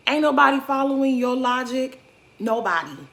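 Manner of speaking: exasperated call-out; emphatic rant. Real, not acted. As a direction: shut him down hard. Ranting at player on screen.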